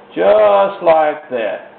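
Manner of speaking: "Just like that" drawn out very slowly; slow demo. Shown as a demonstration. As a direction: stretch out "Just like that" to show the stroke. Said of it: "you see how that's done".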